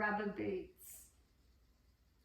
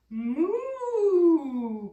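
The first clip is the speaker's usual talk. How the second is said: loud cow moo call; 7 semitones higher, 12 dB louder than usual. Acted. Narrator voices Tom mooing.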